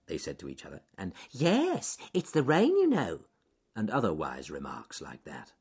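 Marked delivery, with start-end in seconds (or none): 1.3-3.3 high-pitched animal know-it-all; squeaky forest-animal chatter; small animal. A narrator's reading. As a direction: pipe up with an obvious remark. Another animal replies wisely.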